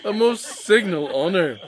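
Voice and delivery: loud, high effusive praise; gushing congratulations. Acted. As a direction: burst out with delight. Rumbelow gushing.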